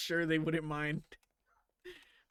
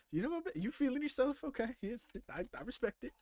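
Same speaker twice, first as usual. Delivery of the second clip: playful teasing; 10 semitones higher than usual. Real, not acted. Teasing chat about a bold choice.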